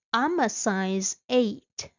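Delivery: ordinary delivery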